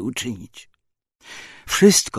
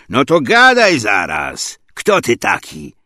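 loud, slow demand from a commander; forceful officer's interrogation; angry interrogator; 7 dB louder than usual. Acted. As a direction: demand an answer angrily. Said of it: "komandir rozsierdził się na mnie".

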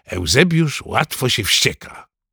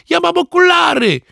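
put-on very high, squealing boy's cry; panicky shout; 4 dB louder than usual. A narrator's reading. Ananiasz being chased by Rufus, shouting in alarm. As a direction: shout it as a high-pitched plea. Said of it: "który krzyczał".

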